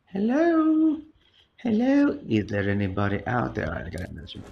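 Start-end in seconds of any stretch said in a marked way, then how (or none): 0.1-2.2 long squeaky high hello, then a rising one; silly falsetto calling out. Pretend. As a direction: keep calling way up high until they answer. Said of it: "I'm gonna keep saying hello".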